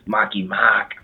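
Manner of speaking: put-on whispering in a Boston accent; hushed Boston accent; whispered Boston accent. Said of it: "we'd have to do a Boston accent".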